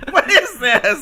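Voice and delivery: high, slow, laughing question; baffled at the moldy food; high laughing disbelief; laughing disgust. Real, not acted.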